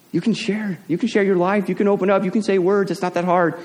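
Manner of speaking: high, teasing coaxing voice; mock pep talk. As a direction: coax shy parents to talk, sing-song. Mocking the silent sideline parents.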